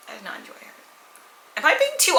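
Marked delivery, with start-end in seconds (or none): 0.1-0.7 "I did not enjoy her" said again much softer, almost under the breath; quiet repeat. Shown as a demonstration. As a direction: drop the repeat to a near hush.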